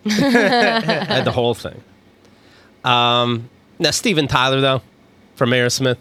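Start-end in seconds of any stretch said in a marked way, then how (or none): 0.0-1.8 slower, higher, laughing through it; laughing emphasis. Shown as a demonstration. Bragging about owning a whole album, slow laughing delivery. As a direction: stretch it out, amused at yourself. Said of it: "we live in a world of MP3s".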